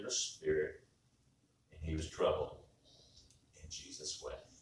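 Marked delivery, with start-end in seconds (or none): 1.7-4.6 low, soft and grave; hushed scripture reading. A narrator's reading. Preacher reading that Jesus wept, hushed and solemn. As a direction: let the words sink almost to a whisper.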